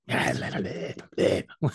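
voiceless tongue-flapping gibberish; imitated possessed babble. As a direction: mimic babbling with the tongue going. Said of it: "was screaming ... literally was going like".

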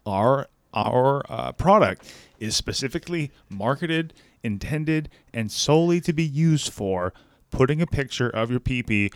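usual speaking voice

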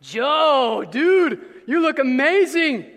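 loud, excited admiring praise; impressed friend. Retold or imitated. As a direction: gush over the new look. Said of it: "They say to you".

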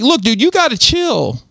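high, drawn-out put-on voice; slow high-pitched mock plea to calm down. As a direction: stretch it out high, pitch swooping. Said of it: "I was doing this voice".

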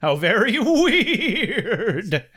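slow, drawn-out with a laugh; mock drawl. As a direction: stretch it out, amused.

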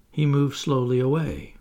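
ordinary delivery

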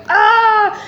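high, wailing mock scream; acted shriek of alarm; panicked scream. Patient hearing her flight about to board, panicked scream.

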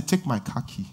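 put-on soft, low reassurance to a child; soothing father. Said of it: "I say".

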